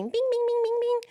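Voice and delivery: high sing-song bing bing imitating a ringtone; mock phone ding. Imitating her phone's ding while searching. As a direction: chime it like a phone ringing.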